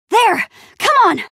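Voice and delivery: high, loud urging; urgent call. Acted. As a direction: shout to hurry a companion along. Urging companion away from danger.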